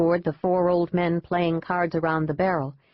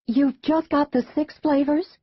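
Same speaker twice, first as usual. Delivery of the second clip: tourist mother asking; 9 semitones higher than usual. A narrator's reading. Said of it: "the woman asked".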